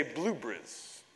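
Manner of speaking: put-on blueberries in an English accent, clipped to BLUE-bries. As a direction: squeeze the middle of blueberries the English way. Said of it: "if you wanna practice an English accent".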